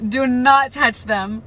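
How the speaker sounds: "Do not touch them" shouted slowly, each word loud; slow loud warning. Real, not acted. Repeated warning, louder.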